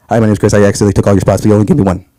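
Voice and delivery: low, deadpan message voice; sheepish email readout. Retold or imitated. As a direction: read your awkward email out low. Reads out his sheepish email.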